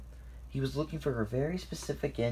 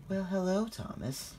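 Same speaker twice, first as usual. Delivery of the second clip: higher, soothing ladylike greeting; soothing feminine voice; 9 semitones higher than usual. A narrator's reading. Lady greets Thomas after a long absence, soothing feminine voice. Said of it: "greeted in her soothing feminine voice".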